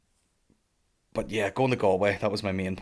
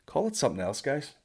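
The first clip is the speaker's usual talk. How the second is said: usual speaking voice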